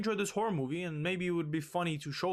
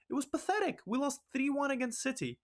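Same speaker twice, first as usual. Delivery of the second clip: pitched way up, exasperated outburst; real angry venting over a lost match; angry rant; 9 semitones higher than usual. Real, not acted. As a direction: let the frustration push your voice much higher. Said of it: "I've never been more pissed".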